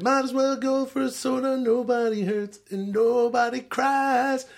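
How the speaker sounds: high, slow imitation of a rock singer. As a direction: croon it like a Canadian rock singer. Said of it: "It's a guy who's like".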